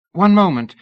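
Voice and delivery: high, loud call to stop them. A narrator's reading. Missus Tournachon stopping Lady Ada from leaving, loud call. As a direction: halt them before they go.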